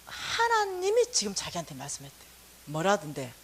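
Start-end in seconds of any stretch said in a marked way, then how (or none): none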